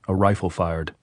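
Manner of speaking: ordinary delivery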